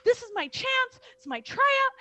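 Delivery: loud, high-pitched, breaking the big news; excited. Retold or imitated. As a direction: gush over Mom as if there is no time to lose. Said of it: "I was just so excited".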